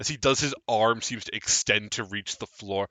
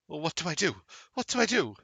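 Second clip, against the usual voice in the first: panicked mage lady; 8 semitones higher, much faster than usual. Acted.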